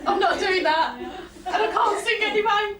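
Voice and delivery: laughing, pitch climbing high; amused self-quote, half laughing. Retold or imitated. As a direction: giggle through the refusal. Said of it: "you heard me say to him".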